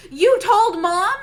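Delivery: shocked, slow, loud question; surprised. Retold or imitated. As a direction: say it in disbelief. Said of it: "she sounded surprised rather than angry".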